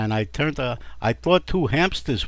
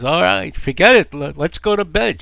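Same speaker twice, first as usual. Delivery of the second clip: exasperated husband; 5 semitones higher, 7 dB louder than usual. Acted.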